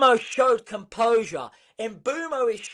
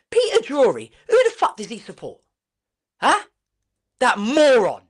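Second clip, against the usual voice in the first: loud, exasperated outburst; louder indignant rant; angry rant; 7 dB louder than usual. Real, not acted. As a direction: vent at the commentator. Ranting about the commentator.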